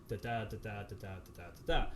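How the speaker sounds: da da da da da da da da da da chanted in strict iambic beat, weak-strong; robotic da-DUM pulse, no inflection; iambic rhythm. Shown as a demonstration. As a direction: tap out the meter like a metronome. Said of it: "the rhythm's".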